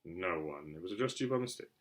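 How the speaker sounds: low, soft, short uncle; lightly voiced curt uncle. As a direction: cut him off, low and terse. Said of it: "said Uncle Vernon shortly".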